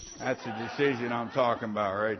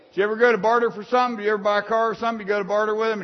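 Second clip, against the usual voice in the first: loud, high, fast preaching; fiery preaching; 8 semitones higher, 10 dB louder than usual. Acted. Preacher exhorting the congregation, loud fast preaching. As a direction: drive it home to the congregation.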